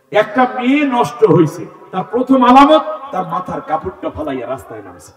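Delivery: loud, high preaching cry; emphatic warning. Real, not acted. Preacher warning about a ruined girl, loud and emphatic. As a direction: proclaim it forcefully to the crowd.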